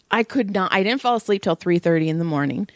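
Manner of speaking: ordinary talk, nothing marked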